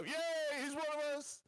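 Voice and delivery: high, mock-jubilant cheer; cheering crowd. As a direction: cheer like a whole crowd welcoming its own. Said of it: "all the Americans go".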